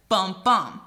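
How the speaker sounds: "bum, bum" with the second bum stressed; unstressed-stressed beat. Shown as a demonstration. Teacher demonstrating iamb rhythm, stress on second bum. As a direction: beat out "bum, bum", weak then strong. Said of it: "So unstressed, stressed".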